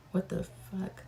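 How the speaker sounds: shocked aside to herself, whispered; whispered curse. Real, not acted. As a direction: mutter it under your breath in disbelief. Reacting to odd questions, then apologising.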